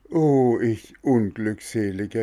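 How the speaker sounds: high lament on the Oh, then tapering; wailing. Acted. Puppet swallowed by the shark, wailing lament. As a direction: cry out in misery. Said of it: "jammerte er".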